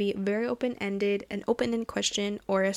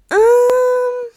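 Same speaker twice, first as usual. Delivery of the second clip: long, loud high-pitched whine; agonized groan; 14 semitones higher, 11 dB louder than usual. Real, not acted. Podcaster stumped by a listener's question, agonized groan. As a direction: hold a loud, high sound of indecision.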